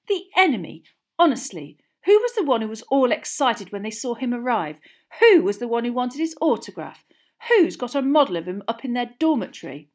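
high, loud indignant retort. A narrator's reading. Girl throwing her friend's own fandom back at him, indignant. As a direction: fling back question after question, loud. Hermione hits back at Ron.

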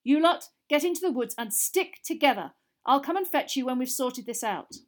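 shouting over the noise; urgent orders. A narrator's reading. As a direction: send them off to the woods, loud. Mr Weasley ordering kids away.

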